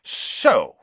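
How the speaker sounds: loud, triumphant pounce; forceful, gloating triumph; savage triumph. A narrator's reading. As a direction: land it with savage satisfaction. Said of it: "with a kind of savage triumph".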